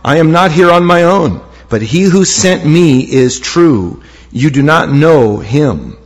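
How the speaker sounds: normal talking voice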